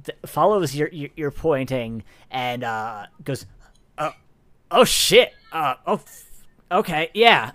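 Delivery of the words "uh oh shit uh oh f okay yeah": flustered, higher and louder; startled guard. Acted. Game master's guard spots the fire, startled and flustered. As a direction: stammer in sudden alarm. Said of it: "the security guard, uh, looks at you".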